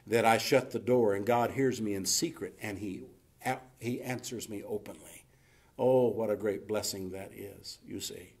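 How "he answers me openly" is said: ordinary delivery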